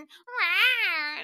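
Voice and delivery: loud, high mock roar; imitated squawking moan.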